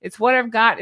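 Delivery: ordinary delivery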